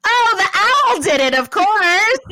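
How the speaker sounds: high, loud mocking sarcasm; sarcastic. Real, not acted. Sarcastic about owl theory.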